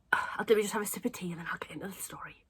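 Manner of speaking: quick whisper to herself before a sip of tea; hurried hushed aside. Real, not acted. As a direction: whisper it fast, half to yourself. Aside while sipping tea.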